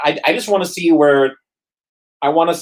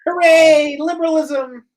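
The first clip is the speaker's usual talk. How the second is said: sarcastic cheer; 13 semitones higher, slower than usual. Acted. Sarcastic cheer about California law.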